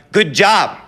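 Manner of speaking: high, loud burst of imagined praise. Acted. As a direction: cry it out like long-wished-for applause. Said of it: "wanted him to be like".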